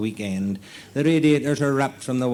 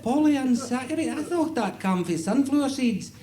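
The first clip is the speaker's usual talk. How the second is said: high-pitched, puzzled government man; comic high voice, stumbling in disbelief; puzzled official; 12 semitones higher than usual. Acted. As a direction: query the odd word in a high voice. Official queries the cow's description.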